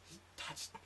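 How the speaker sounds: put-on high girly whisper; tiny frightened whisper; whispered girlfriend. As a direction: girlfriend whispering it a third time.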